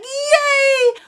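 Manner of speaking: very high, gleeful mock squeal; happy cheer. Friends so happy to find a spot, high gleeful cheer. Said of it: "We were so happy".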